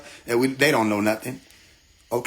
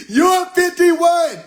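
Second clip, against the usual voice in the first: much higher, louder and slow; stretched loud high cry; mock yell; 13 semitones higher, 6 dB louder, much slower than usual. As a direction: bellow it out like a warning. Yelling the count at her.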